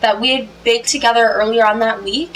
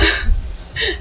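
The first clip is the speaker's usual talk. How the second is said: airy laugh; 9 semitones higher, 4 dB quieter than usual. Real, not acted. Laughing at own impression.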